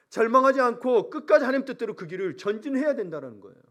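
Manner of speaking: preacher's rallying cry; forceful preaching. Real, not acted. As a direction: urge the congregation on with force. Preaches an exhortation at full force.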